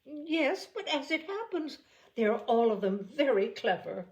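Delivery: high indignant matron; lightly voiced fussy mother; Mrs Bennet. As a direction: play the offended mother, pitch up. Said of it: "Mrs Bennet retorts".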